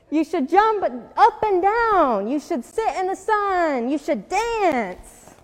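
high, loud, drawn-out suggestions; insistent urging. A narrator's reading. Animals urge Jim to join in, loud and excited. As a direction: stretch out the action words emphatically.

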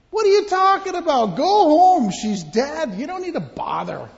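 high, loud mocking; mocking crowd. Mourners mocking Jesus, high mocking voice. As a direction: brush him off with scorn.

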